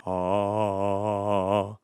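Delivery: lowest sung ah, soft; deepest note. Shown as a demonstration. Singer hunting for her deepest chest note. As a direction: sink to the bottom of the range. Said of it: "I'm trying to find my deepest note".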